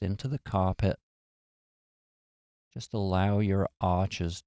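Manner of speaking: calm, unhurried meditation voice with long pauses; guided meditation. Real, not acted. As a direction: gently guide them into relaxation, leaving space after each phrase.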